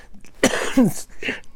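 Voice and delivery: harsh cough; coughing fit. Real, not acted. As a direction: cough through it. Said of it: "Excuse me".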